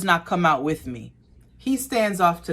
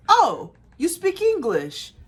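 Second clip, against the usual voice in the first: surprised customer; 13 semitones higher, 3 dB louder, slower than usual. Retold or imitated. Customer surprised George speaks English.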